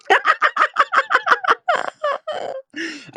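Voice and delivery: long high laugh at her own tease; high giggle. Real, not acted. Host teasing Poppyana, giggling. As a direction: giggle at the joke.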